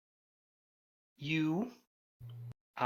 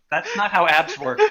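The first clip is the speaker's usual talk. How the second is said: higher, louder, through real laughter; laughing objection; 6 semitones higher, 5 dB louder than usual. Real, not acted. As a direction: correct them while cracking up. Game master laughing at the joke.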